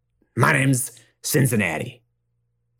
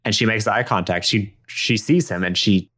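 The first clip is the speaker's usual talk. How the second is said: ordinary delivery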